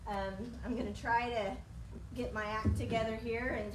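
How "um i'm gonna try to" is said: ordinary talk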